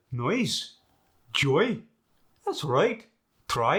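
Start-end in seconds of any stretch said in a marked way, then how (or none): 0.1-1.8 "Noise, joy." rising on second vowel; internal vowel lilt. Shown as a demonstration. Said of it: "Let me do it again".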